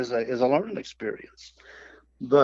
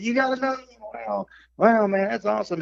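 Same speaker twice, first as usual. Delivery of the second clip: high mock excited brother; 8 semitones higher than usual.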